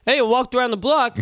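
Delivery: mock dumb-guy voice; dopey guy imitation. Woman imitating guys who walked the dog, dopey voice. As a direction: do a clueless man making excuses.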